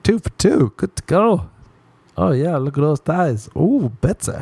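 high-pitched, hammed-up appreciation; mock ogling, pitch swooping up; leering admiration. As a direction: gush over it in a high voice. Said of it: "you'd be like".

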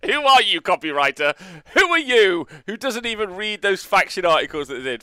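high, loud indignant mock rant; mock outrage. Streamer scolding the copywriter over army blurb, mock outrage. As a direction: playfully shout down the offending writer.

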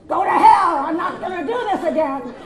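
hammed-up angry yell; loud comic blow-up; furious shout. Imagined blow-up at phone company.

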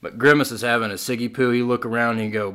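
ordinary talk

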